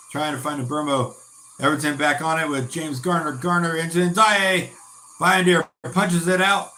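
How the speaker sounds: brisk commentary calling each pass; live play-by-play. Acted. Streamer narrating the match live for his viewers, in a brisk play-by-play. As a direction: call the action as it happens.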